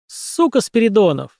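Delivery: high-pitched wail of rage; angry cry. A narrator's reading. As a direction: curse him in a high cry. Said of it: "этот вопль Ритки Кукис".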